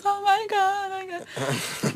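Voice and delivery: high, mock-tearful Oh my God; imitating a weepy mom; mom crying. Mom at the stadium closing, mock-crying. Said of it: "And my mom cried".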